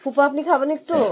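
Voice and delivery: loud, high call to someone away. Real, not acted. Asking uncle whether he will have some tea, calling out loudly. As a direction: raise the voice and pitch to offer across the house.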